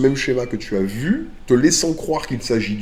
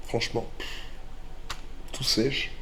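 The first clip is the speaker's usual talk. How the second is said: quiet; 10 dB quieter than usual. Real, not acted. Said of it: "j'ai une angine là".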